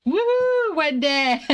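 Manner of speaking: high, loud whoop of delight; excited cheer. Real, not acted. Thrilled cheer to Wendy after her correct guess. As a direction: cheer her on like she just scored.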